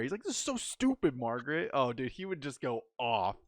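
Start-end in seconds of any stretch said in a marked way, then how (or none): 0.2-1.7 furious rant. Retold or imitated. A friend imitating dad Jeff raging at a diaper, furious rant. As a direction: vent like a dad sick of blowouts. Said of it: "Jeff was just getting furious".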